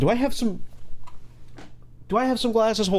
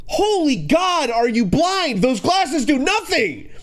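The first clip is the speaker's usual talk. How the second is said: exasperated outburst; 8 semitones higher, slower than usual. Real, not acted.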